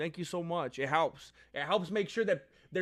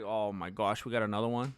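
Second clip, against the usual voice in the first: lower, checked-out sigh of a bored car salesman; weary salesman groan; 7 semitones lower than usual. Retold or imitated. Car salesman sighing at another customer, weary. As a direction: let the boredom drag the words. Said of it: "my impression of selling the car was basically like".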